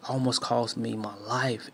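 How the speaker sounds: ordinary delivery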